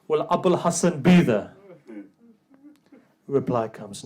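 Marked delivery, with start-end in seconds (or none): 0.1-1.6 higher, much louder pressing question; daughter's urgent question. Acted. Daughter asking her father about her husband, urgent and raised.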